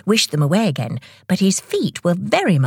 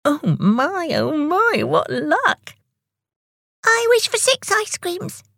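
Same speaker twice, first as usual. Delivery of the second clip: very high-pitched little character voice; Tick, gleeful; 13 semitones higher, slower than usual. Acted. Tick greedily greets the lucky wish, gleeful high voice. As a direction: squeal it with delight, high up. Tick's delight at wishing.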